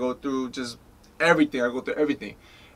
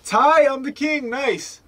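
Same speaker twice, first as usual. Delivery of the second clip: higher, thrilled little cheer; excited; 11 semitones higher, 4 dB louder, slower than usual. Retold or imitated. To himself on getting the king card, a thrilled cheer. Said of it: "And I was like".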